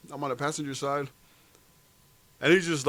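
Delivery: ordinary talk, nothing marked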